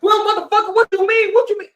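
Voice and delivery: high, loud furious outburst; hammed-up rage, loud and high; mock angry yell. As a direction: blow up at them. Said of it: "he's like".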